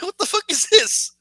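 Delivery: high, baffled question; stunned disbelief. Retold or imitated. Viewer learning of the husband's affair, high stunned disbelief. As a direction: pitch up in comic outrage.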